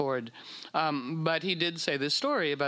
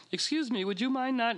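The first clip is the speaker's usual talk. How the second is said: sweetly polite request, pitched higher; overly polite; 8 semitones higher than usual. Retold or imitated. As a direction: ask with forced politeness. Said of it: "I was very polite".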